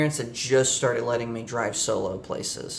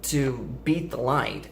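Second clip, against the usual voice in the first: ordinary delivery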